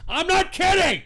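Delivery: loud, indignant outburst. Real, not acted. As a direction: blurt it out loud, shocked. Outraged at chat room.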